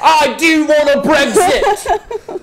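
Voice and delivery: hammed-up British accent, high and loud; mock British accent. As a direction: play a fired-up Brit vowing to Brexit. Mock Brits quarrel over Brexit.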